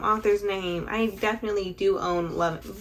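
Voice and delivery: normal talking voice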